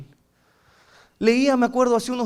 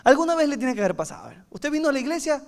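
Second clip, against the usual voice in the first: ordinary delivery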